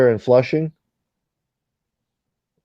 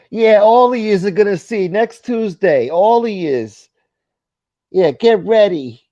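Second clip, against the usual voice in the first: much higher, louder, slow taunting voice; mocking impression; 11 semitones higher, 5 dB louder, slower than usual. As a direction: ham up his old cliffhanger threat. Mocking old Tuesday threats.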